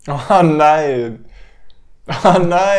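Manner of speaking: loud hammed-up dismay; mock horror. Host reacts to partner googling symptoms, loud mock horror. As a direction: cry out in mock despair.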